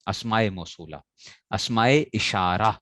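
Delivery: ordinary talk, nothing marked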